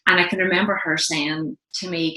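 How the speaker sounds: normal talking voice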